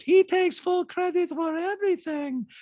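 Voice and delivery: put-on very high-pitched accented caricature; squeaky falsetto mimicry of a reality star; Diana impression. Host mimics reality star in recap, high-pitched caricature. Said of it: "And Diana goes".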